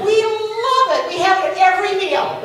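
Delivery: high, loud, gushing acceptance; gush, stretching the first words; delighted reply. Retold or imitated. Friend offered a ham for her kids, delighted.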